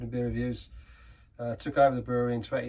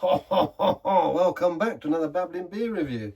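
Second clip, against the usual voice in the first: laughing; 6 semitones higher, 7 dB louder, slower than usual. Real, not acted. Show intro after French greeting.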